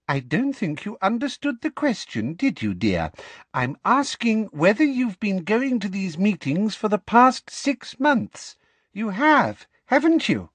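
Umbridge pressing sweetly. Acted.

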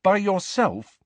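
can hardly believe it; mild awed question; amazed. Acted. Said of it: "amazed and impressed".

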